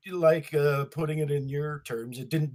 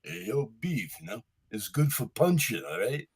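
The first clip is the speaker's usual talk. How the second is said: Stallone impression; 6 semitones lower, 4 dB quieter than usual. Auditioning as Stallone for a beef ad, low New York mumble. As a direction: mumble it like a lunkhead boxer. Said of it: "Mister Stallone".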